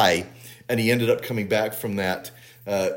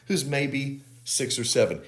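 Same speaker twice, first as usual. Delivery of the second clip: usual speaking voice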